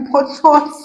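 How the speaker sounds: amused, chuckling botos; laughing at the word. Real, not acted. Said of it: "it sounds somehow to me".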